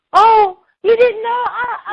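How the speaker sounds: high, loud tearful cry, slowed down; reliving the secretary breaking into tears; retold crying. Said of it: "then she dropped the phone and she's crying".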